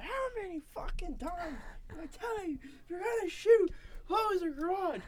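high, furious mom yell. Retold or imitated. Mom fuming at her son for breaking the drywall, angry yell. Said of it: "she was fuming".

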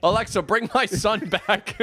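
high, hammed-up plea breaking into laughter; mock desperate plea. Parent to Alexa about a lost son, mock desperate. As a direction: beg the device, half laughing.

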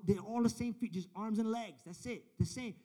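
ordinary talk, nothing marked

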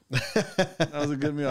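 drawn-out content sigh of a line; slow satisfied diner. Retold or imitated. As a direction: savor it slowly, like a pleased diner. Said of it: "I'm like".

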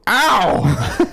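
sudden high, loud "ow"; loud yelp of pain. Real, not acted. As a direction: yelp as if just bitten. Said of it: "Wow, that was kinda loud".

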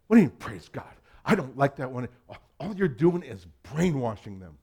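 imitating an irritated woman confronting him; angry retort. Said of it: "She was mad".

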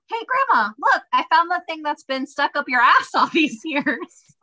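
high, snarky teasing call; sarcastic and snarky. Retold or imitated. Granddaughter needling Grandma about her find, sarcastic and snarky. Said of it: "a little bit sarcastic and snarky".

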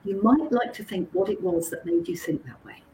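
ordinary talk, nothing marked

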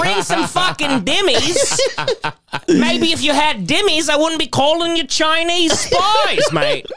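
hammed-up Australian yob complaining about Korean barbecue; Australian bloke. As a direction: whinge about the restaurant service like an Aussie. Continues mock Aussie rant.

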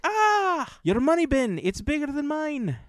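high, lilting leprechaun voice. Retold or imitated. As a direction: squeak it out like a leprechaun. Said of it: "He just talks just like a leprechaun".

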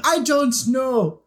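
a hint of a grand old English dame, drawn out slowly; faint posh-old-lady impression; Maggie Smith voice. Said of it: "I went into Maggie Smith".

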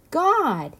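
an emphatic, high storybook antelope; insistent antelope. A narrator's reading. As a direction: insist on it, pitched well up. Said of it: "insisted the antelope".

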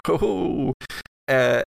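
ordinary talk, nothing marked

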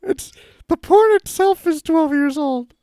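squeaky high character voice; high put-on voice. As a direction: pitch it way up and whiny. Same held high voice.

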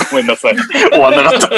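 giggling through the apology; can't stop laughing. Real, not acted. Breaks up laughing mid-read.

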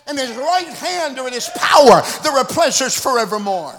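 loud preached proclamation; preacher shouting the promise; shouted scripture. Acted. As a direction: throw the verse out to the congregation.

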